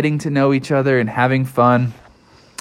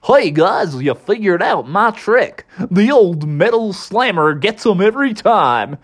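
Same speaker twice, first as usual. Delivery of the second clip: higher, swooping goofy voice; Goofy character voice; 6 semitones higher than usual. A narrator's reading. Goofy admits his slammer trick, high goofy voice. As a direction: own up cheerfully as a goof.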